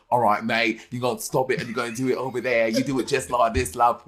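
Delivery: imitating a friend's British accent. Co-host mimicking the host's British accent, teasing. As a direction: do a mock British accent, matey. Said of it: "every time you do British accents".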